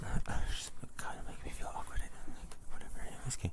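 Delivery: breathy ASMR whisper, barely voiced; tingly asmr mouth sounds.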